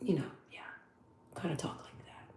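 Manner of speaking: very soft, hushed, toned-down talk; softened-up voice. Shown as a demonstration. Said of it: "soften it up and kinda".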